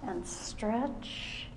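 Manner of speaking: soft whispered stretch, and stretch; hushed whisper guiding the stretch; whispered cue. Shown as a demonstration. Instructor whispers stretch cues.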